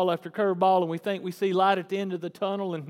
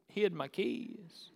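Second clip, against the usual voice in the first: ordinary delivery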